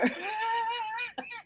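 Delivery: high, breaking cry-laugh of retold tears. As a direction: sob and laugh at once, remembering. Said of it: "so I'm crying".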